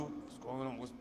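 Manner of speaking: hushed, low imitated what's going on; mock confused murmur. Preacher mimicking the angry scientists, hushed. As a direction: mimic the puzzled crowd under breath.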